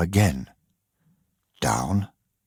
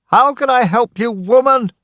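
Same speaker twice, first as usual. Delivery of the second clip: loud, exasperated shout; a tired man shouting in the storm; 5 dB louder than usual. Acted. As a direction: snap back at her, loud. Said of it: "Daniel shouted at Missus Dawson".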